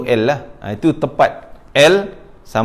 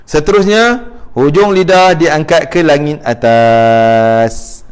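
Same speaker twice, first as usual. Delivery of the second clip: slow, raised start, final word held long; emphatic announcement; 4 dB louder, slower than usual. Real, not acted. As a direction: announce the rule with a long-drawn ending. Teacher announces next articulation point. (umumkan perlahan, hujungnya meleret)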